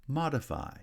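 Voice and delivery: "Modify" starting high on "Mod"; first-syllable primary stress. Shown as a demonstration. Teacher showing stress patterns, first syllable strongest in "Modify". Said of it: "has primary stress on the first syllable".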